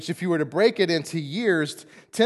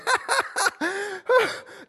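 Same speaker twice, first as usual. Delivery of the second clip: high mocking burst of laughter; high scornful cackle. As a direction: laugh him off as absurd. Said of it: "he's just laughing out loud".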